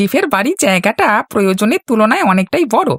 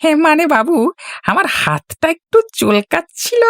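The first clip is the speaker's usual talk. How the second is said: sly hint; 5 semitones higher than usual. Acted. Henchman hinting for a bribe.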